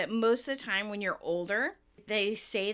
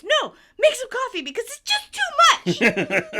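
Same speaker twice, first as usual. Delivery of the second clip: very high, loud frantic outburst; Phoebe shouting; 13 semitones higher, 11 dB louder than usual. Retold or imitated. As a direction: shout in overwhelmed urgency, near laughing. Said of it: "Then she shouts".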